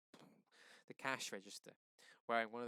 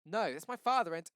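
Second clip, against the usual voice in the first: high, much louder protest; desperate shout; 8 semitones higher, 13 dB louder than usual. Acted. Seven shouts at the cops about his father. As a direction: plead loudly for his father.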